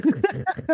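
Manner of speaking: short high laugh. Real, not acted. Host amused by the pile of books, laughing. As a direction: laugh at the guest's answer.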